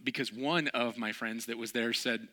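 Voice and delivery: ordinary talk, nothing marked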